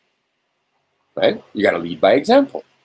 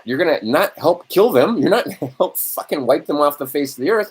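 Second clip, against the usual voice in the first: ordinary talk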